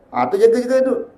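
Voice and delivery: normal talking voice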